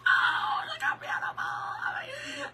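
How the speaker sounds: high, cracking squeal of joy; squealing delight. Real, not acted. Showing florals to viewers, teary high squeal. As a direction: squeal like you might cry from happiness.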